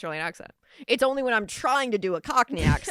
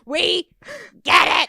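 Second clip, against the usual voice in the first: loud, high, fed-up listeners; mock shout of fed-up listeners; mock exasperated shout; 9 semitones higher, 9 dB louder, slower than usual. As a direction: yell it like annoyed fans.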